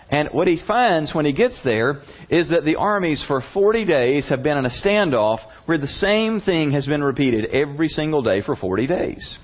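ordinary talk

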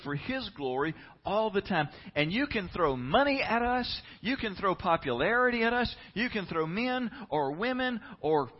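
high, ringing sermon delivery; impassioned preaching. Real, not acted. Preacher telling church we are the fish, impassioned preaching. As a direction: drive each phrase up like a preacher at full force.

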